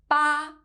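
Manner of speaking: "八" held high and level; first flat tone. Shown as a demonstration. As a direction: keep "八" on one high, even note. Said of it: "has the first flat tone".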